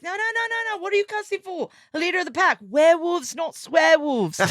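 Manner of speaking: high-pitched, fussy scolding, a touch mock; prim pack leader telling the others off; scolding werewolf leader. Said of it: "And the guy's like".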